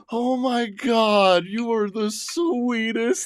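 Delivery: high, slow, swooning coo of a bridesmaid; drawn-out high gush over a sweet guy. Retold or imitated. Bridesmaids swooning over him dancing with grandma, high cooing gush. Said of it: "they were like".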